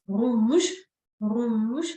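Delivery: "rouge" with a tickling throat R, vowel drawn out; French throat R. Shown as a demonstration. Teacher shows the French R to learners, drawn-out throaty "rouge". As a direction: say "rouge" slowly, feeling the R tickle your throat. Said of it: "how it's tickling a little bit your throat".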